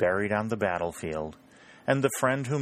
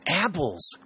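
excited; 9 semitones higher than usual. Acted. Colonel startled by sudden apples.

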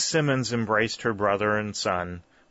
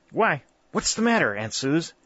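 anxious; 7 semitones higher than usual. A narrator's reading. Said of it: "asked Jarvis anxiously".

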